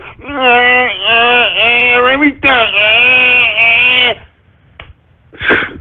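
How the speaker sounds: slack-jawed stammering eh; mocking dumbstruck kids. Mocking young people struggling to answer a question. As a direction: play a gaping kid stuck for words. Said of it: "as their faces and mouths drop, like".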